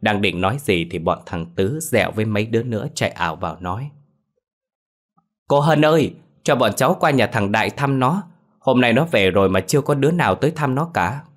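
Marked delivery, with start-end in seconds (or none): 5.5-11.2 put-on children calling. A narrator's reading.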